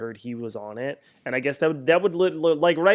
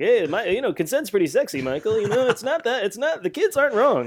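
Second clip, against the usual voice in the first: cartoony, higher-pitched [name] take; action hero voice; 8 semitones higher than usual. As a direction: ham up a cocky action hero. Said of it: "kind of cartoony kind of flair to your voice there".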